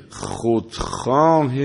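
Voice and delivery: ordinary talk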